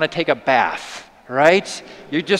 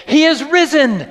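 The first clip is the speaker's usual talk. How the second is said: high, loud, joyful cry with risen ringing out; excited announcement; 9 semitones higher, 6 dB louder than usual. Acted. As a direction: burst through the door with the news. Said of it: "and then they said".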